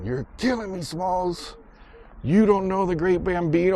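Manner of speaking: high-pitched mock exasperation; high, incredulous movie-quote voice; exasperated movie kid. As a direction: imitate a kid who can't believe it. Quotes exasperated kid's famous line.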